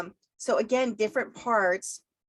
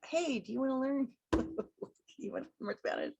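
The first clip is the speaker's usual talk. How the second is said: friendly invitation; 6 semitones higher, 5 dB quieter than usual. Pretend. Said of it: "I'm waiting for those".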